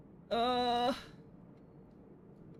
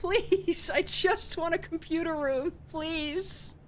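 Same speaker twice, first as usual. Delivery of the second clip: high, whiny begging; desperate plea to the game; pleading; 6 semitones higher, slower than usual. Real, not acted. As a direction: plead high, drawing out each please.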